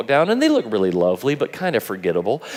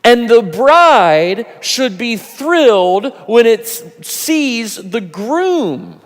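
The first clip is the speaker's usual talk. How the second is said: higher, insistent, slowing on the key words; urgent pleading; 10 semitones higher, 6 dB louder, slower than usual. Retold or imitated. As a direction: grab their attention and drive it home. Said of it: "What John is saying is".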